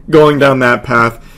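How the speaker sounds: ordinary delivery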